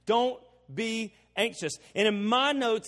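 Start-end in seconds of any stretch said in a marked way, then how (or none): 0.0-1.8 slow command with "be" set apart; "don't... be... anxious", firmly spaced; emphatic BE. Shown as a demonstration. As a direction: pause either side of "be". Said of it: "I emphasize the word be".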